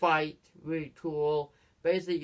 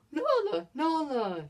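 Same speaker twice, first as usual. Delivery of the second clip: very high-pitched startled call; mock astonished Simba; 12 semitones higher, 3 dB louder than usual. Imitating Simba recognising Nala as she attacks, astonished. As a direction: imitate someone astonished, calling out a name. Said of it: "Simba's like".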